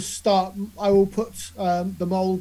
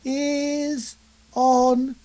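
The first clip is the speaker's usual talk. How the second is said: very slow, drawn-out words, higher pitch; note-taking drawl; 5 semitones higher, much slower than usual. Real, not acted. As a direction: stretch each word as if writing it down. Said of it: "I'm just making some notes".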